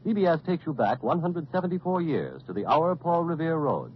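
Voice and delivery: usual speaking voice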